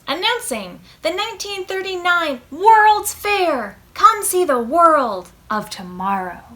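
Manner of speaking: loud, high announcer call from the radio. Retold or imitated. Radio announcing the World's Fair, loud and high. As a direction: boom it out like a 1939 radio ad. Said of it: "And the radio says".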